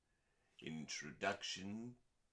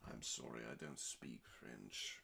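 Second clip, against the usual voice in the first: sheepish aside; 9 semitones lower, 8 dB quieter than usual. Real, not acted. Aside after French word.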